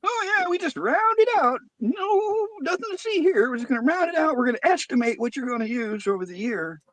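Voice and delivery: very high, airy mock-innocent rep voice; mock the company's fake innocence, way up high. Friend mocking the power company, sing-song high and innocent.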